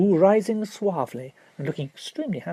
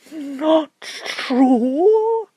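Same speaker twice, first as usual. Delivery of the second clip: high, loud disbelieving echo; incredulous barrister; 11 semitones higher, 5 dB louder than usual. Acted. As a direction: throw it back at her in disbelief.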